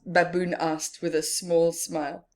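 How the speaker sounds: usual speaking voice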